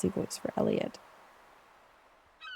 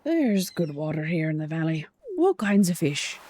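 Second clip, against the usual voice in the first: put-on old fisherman chatting up the newcomer, hearty; 4 dB louder than usual. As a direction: play a jolly weathered fisherman. Voicing Willy the fisherman.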